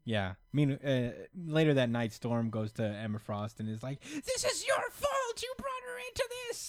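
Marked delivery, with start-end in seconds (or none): 4.0-6.7 high accusing voice. Retold or imitated. Storm voiced accusing Emma.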